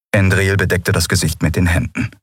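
plain reading voice

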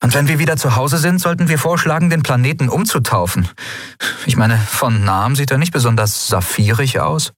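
a hint of a fed-up young captain; Endriel's voice. A narrator's reading.

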